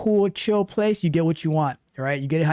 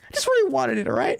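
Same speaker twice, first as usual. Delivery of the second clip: mock pouting. Host mimicking friend admitting he wanted promotion, mock pout. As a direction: imitate a sulker reluctantly owning up. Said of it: "You kind of are pouting".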